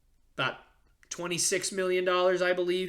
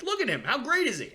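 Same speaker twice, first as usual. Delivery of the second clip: higher, eager hype to his dad; excited fan gushing; 7 semitones higher, faster than usual. Retold or imitated. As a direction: gush like a kid showing off a player. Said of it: "And, like".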